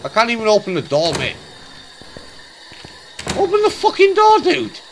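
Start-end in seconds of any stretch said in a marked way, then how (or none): 3.4-4.8 high, loud frustrated yell. Real, not acted. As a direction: yell at the stuck door. Stuck at door, frustrated.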